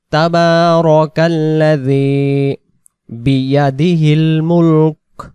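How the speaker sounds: slow melodic tajweed reading; Quran recitation. Shown as a demonstration. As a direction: recite it as a model for students. Repeated recitation drill. (তাজবিদসহ তিলাওয়াত)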